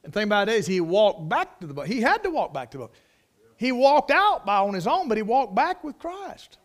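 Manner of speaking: loud, high, driving pulpit delivery; full-force preaching. Acted. Preacher exulting over Peter walking back with Christ, full force. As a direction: drive the point home at full voice.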